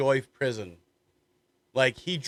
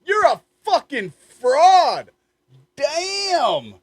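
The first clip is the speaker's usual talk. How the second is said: much higher, loud shocked yell; excited shout; 10 dB louder, much slower than usual. Real, not acted. Watching the heckler live, loud shocked shout.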